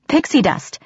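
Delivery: high, desperate outburst; desperate blurt. A narrator's reading. Captive girl answers the threatening chief, blurting desperately. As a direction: blurt it out to save yourself. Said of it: "I blurted desperately".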